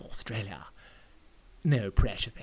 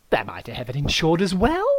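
ordinary delivery, nothing marked

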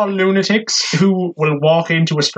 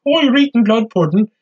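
mock Irish landlady; 6 semitones higher than usual. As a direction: play the offended landlady scolding a tourist. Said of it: "It's like".

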